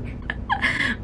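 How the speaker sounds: airy high rueful laugh; quiet self-pitying laugh; soft sad laugh. Real, not acted. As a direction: laugh off the loneliness. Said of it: "falling by myself".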